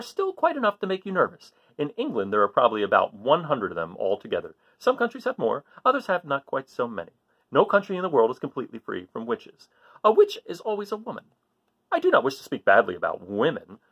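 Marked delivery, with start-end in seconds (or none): none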